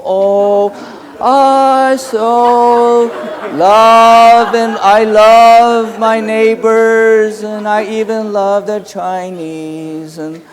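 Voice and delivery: high, loud, slow hammed-up sing-song holy voice; mock holy man. Mocking a holy man before the audience, high slow hammed-up sing-song. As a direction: ham up a sugary saintly preacher, drawn out and high. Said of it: "holy and speaking softly".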